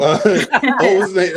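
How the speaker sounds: ordinary talk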